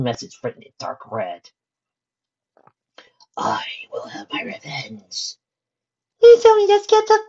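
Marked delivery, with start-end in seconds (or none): 3.4-5.4 slow, chilling whisper; menacing whisper. A narrator's reading. Reads a chilling written threat. 6.2-7.2 very high, loud squeak. A narrator's reading. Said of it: "squeaked Mabel".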